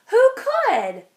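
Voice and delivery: high, loud rising worry; despairing question. A narrator's reading. Narrator wondering who can save the calf, high anxious cry. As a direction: throw up your hands.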